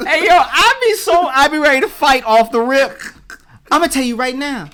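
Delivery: high, loud, laughing mock street tough; hammed-up aggrieved partner. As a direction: play the ready-to-fight partner for laughs.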